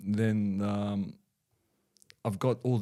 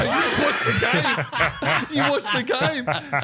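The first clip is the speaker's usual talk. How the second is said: high laughing voice; quoting himself to his wife, laughing; laughing retold quote; 14 semitones higher, 6 dB louder than usual. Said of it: "I go".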